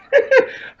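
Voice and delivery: short airless high wheeze; breathless wheeze laugh. Real, not acted. Telling the story, he lets out a short wheezing laugh.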